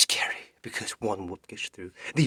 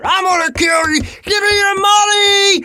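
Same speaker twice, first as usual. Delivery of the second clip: menacing shout; 17 dB louder than usual. Acted. Three dudes chasing her.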